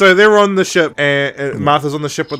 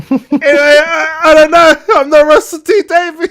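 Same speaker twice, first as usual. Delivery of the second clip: mock despair; 11 semitones higher, 6 dB louder than usual. Real, not acted. Mock despair, raised loud.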